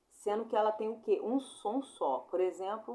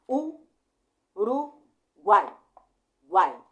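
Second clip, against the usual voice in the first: U, ru, guai each punched with a louder burst of air; syllables split apart; 12 dB louder, much slower than usual. Shown as a demonstration. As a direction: chop "Uruguai" into beats, louder. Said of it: "Fazendo aquela técnica do golpe de, de ar". (sílabas de "Uruguai" separadas com golpes de ar)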